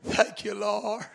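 ordinary delivery

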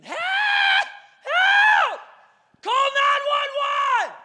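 very high, loud, drawn-out cries for help; imitated screaming. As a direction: scream it desperately, stretching every word. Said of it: "This woman is screaming".

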